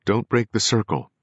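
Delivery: urgent cry to hold the séance; cried out. A narrator's reading. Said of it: "Perry cried".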